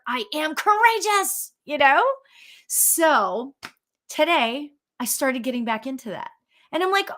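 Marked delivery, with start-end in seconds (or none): 0.1-1.5 bright lifted affirmation. Shown as a demonstration. Host showing a livelier way to say her affirmation, pitched up high. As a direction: say courageous much higher, ringing and proud.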